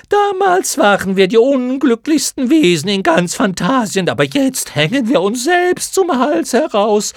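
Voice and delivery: very high, loud, whiny creature voices; a bored swarm nagging its benefactor; chattering moth chorus. Acted. (hoch und laut schnatternde, nörgelnde Mottenstimmen)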